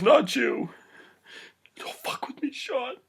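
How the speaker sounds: high voice fading to a whisper; mock upset plea. [name] begging Sean to stop, upset and high. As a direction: plead high, then drop to a whisper.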